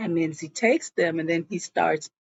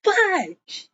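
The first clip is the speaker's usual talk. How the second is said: high mock curse; 11 semitones higher than usual. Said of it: "And she goes".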